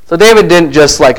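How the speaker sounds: ordinary talk, nothing marked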